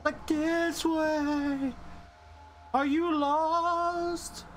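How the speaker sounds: high, very slow sing-song taunt; mock slow guide. Real, not acted. Streamer mocking the game's waypoint, slow high sing-song. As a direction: drag every word out teasingly.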